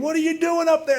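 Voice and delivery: high-pitched acted shout up at someone; retold challenge. Security guard to man on fire escape, mock yelling up. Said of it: "all of this yelling".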